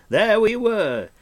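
high, slow exultant cry; cried out. A narrator's reading. Bard telling friends of his battle, exultant cry. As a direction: crow it out proudly. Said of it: "he cried".

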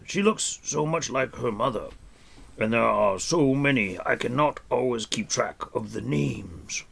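put-on fond, absent-minded old man counting on fingers; muddled old sage losing track; rambling old hermit. A narrator's reading. Old hermit muses about the animals, fond and absent-minded.

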